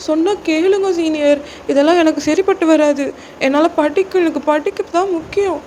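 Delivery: put-on high-pitched, pleading girl character; distressed junior girl. Junior girl begging senior to let her go, distressed pleading. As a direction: beg desperately in a high voice.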